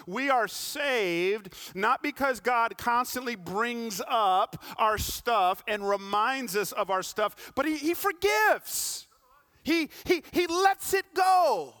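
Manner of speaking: loud, high preaching cadence; impassioned sermon delivery; full-force preaching. Acted. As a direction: preach it out, pressing the climax. Preaching climax on forgiveness.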